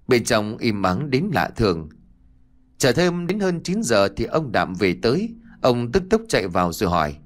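plain reading voice

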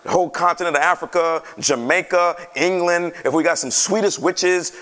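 normal talking voice

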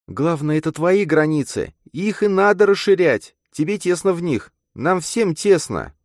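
put-on very high, emphatic girl's voice; insistent girl character. A narrator's reading. Girl urges her friend to widen limits, insistent. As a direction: press the point in a high feminine voice.

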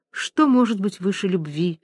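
ordinary delivery, nothing marked

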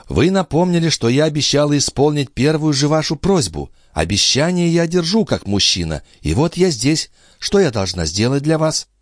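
high-pitched lady's voice; obliging countess. A narrator's reading. Countess keeping her promise to the count, high and obliging.

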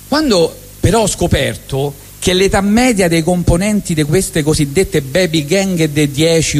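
ordinary talk, nothing marked

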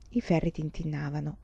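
ordinary delivery

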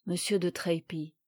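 plain reading voice

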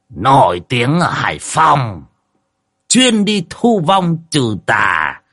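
put-on slow, drawn-out grand presentation by an old medium; showman's introduction. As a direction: announce him like a star, stretching each phrase.

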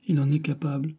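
plain reading voice

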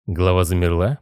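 ordinary delivery, nothing marked

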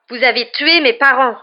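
angry, high, faintly trembling; slightly trembling. A narrator's reading. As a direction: accuse him, voice nearly breaking. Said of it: "la voix légèrement tremblante".

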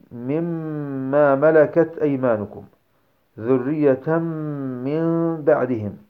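"مما" with a long held nasal M; merge into meem. Shown as a demonstration. Teacher giving examples of the meem, held with ghunnah. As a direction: recite slowly, "ذرية من" as "dhurriyyatammm-min". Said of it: "الميم".

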